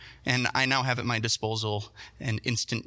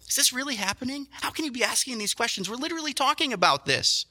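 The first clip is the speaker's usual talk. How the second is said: higher, incredulous questioning; higher, exasperated disbelief; 9 semitones higher than usual. Retold or imitated. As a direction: sound baffled at the disciples. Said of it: "Jesus is feeling something similar".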